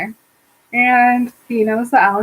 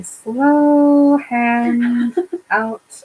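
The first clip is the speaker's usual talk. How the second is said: drawn-out, creeping slow delivery; much slower than usual. Retold or imitated. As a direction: stretch each word in slow motion. Narrator mimes the very slow move.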